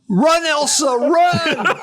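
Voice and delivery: high, dramatic mock yell; retold yell. Urging the runaway Holstein on, mock yelling. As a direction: call out high as if across a field. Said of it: "I yell".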